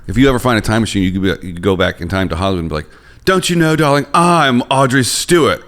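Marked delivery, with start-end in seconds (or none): 3.2-5.6 fifties film star. Pretend. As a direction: play a glamorous starlet. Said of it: "fifties movies acting".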